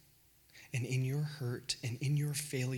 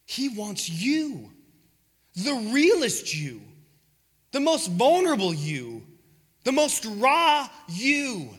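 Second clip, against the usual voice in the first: louder and slower with each phrase, higher at the end; building sermon cry; 7 semitones higher, 10 dB louder, slower than usual. Real, not acted. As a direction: pour it out like a rising plea. Preaching builds to full force.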